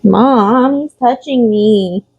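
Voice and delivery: put-on high, slow whiny kid complaint; whiny tattling little sibling. Voicing pets as squabbling kids.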